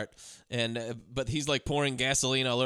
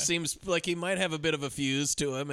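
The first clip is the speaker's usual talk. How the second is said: ordinary talk, nothing marked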